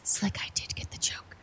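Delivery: hushed unvoiced whisper; stealth whisper. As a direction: whisper it so the guards miss it. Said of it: "Can I do a stealth whisper".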